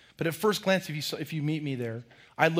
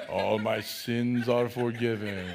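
lower, very slow and lifeless; flat bored hallelujah; 5 semitones lower, slower than usual. Pretend. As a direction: say it like you couldn't care less. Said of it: "we go".

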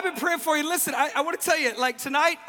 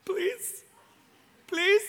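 weak pleading; 9 semitones higher than usual. Retold or imitated. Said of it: "I couldn't even talk".

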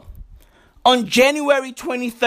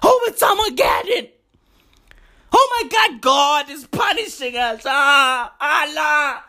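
mock panic; 2 semitones higher than usual. Panicking people on social media, a mock wail. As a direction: ham up the end-of-the-world panic.

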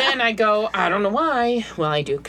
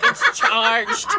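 mock high retort; 12 semitones higher than usual. Own retort in story, high.

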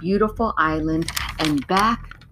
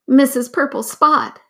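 squawking parrot; 7 semitones higher, 4 dB louder than usual. A narrator's reading. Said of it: "squawked the parrot".

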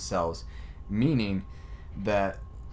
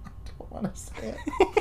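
laughing whisper; 4 dB quieter than usual. Real, not acted.

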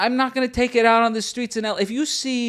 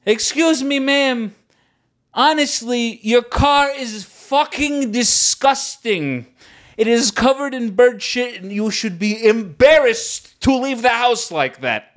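higher, emphatic mock-polite telling-off; retold scolding; 4 semitones higher, slower than usual. Driver retells calling out to woman about dirty car, raised scolding. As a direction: act out scolding a stranger from the car. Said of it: "as I'm pulling out, I go".

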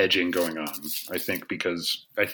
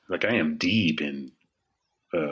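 usual speaking voice

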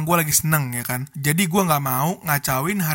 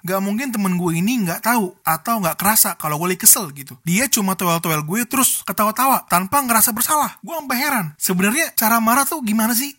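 ordinary talk

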